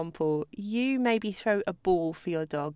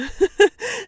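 short high laugh; quick amused laugh; light laugh; 11 semitones higher, 9 dB louder than usual. Real, not acted.

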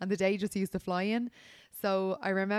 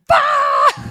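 high, much louder yell of shock; shocked shout; 16 dB louder than usual. Retold or imitated. Friends reacting all together, shocked shout. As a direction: blurt it out in horror. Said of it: "And we were like".

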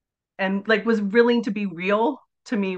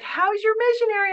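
chirpy asker; 13 semitones higher than usual. Retold or imitated. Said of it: "people would be like".

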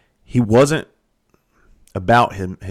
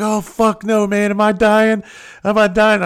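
much higher, louder panicked wail; mock panic; 13 semitones higher, 5 dB louder than usual. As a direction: ham up a man sure he's dying. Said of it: "he started freaking".

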